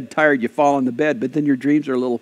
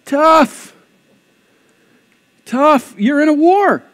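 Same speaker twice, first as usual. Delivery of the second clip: high, loud, forceful dismissal; blunt rebuff; 10 semitones higher, 5 dB louder, much slower than usual. Real, not acted. Pastor answers the reluctant member about war, blunt rebuff. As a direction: shut the complaint down loudly.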